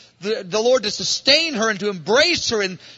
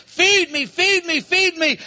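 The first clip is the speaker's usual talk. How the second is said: repeated hungry plea, pitched up; urgent pleading; 7 semitones higher, slower than usual. Acted. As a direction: beg to be fed, rising higher. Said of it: "Remember chapter two, verse five".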